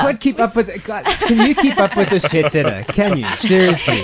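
high, loud, laughing teasing; laughing tease. Real, not acted. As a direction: tease her through laughter. Teasing the jet-setting co-host.

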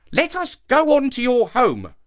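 high, insistent protest. A narrator's reading. Monkey insists on the shark's home, protesting. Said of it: "protested Kima".

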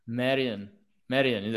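tentative copy of "Marion"; copied pronunciation. Shown as a demonstration. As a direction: repeat "Marion" low and careful, checking it. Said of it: "Am I saying correctly?".

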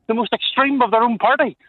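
ordinary delivery

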